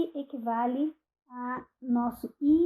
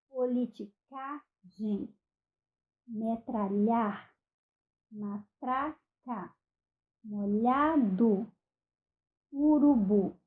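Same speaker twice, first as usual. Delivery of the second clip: slow example words; much slower than usual. Shown as a demonstration. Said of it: "aqui está a nossa sílaba tônica".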